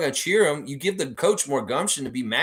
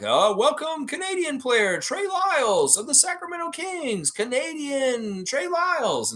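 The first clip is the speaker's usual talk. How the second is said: much higher, drawn-out announcer voice, slowing at the end; mock arena announcer. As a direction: ham up a stadium PA introducing a player. Mocking the arena announcer's welcome.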